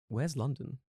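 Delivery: soft, innocent-sounding puzzled question; mock-puzzled retelling; feigned ignorance. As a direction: play clueless, lightly. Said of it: "just to pretend you don't know".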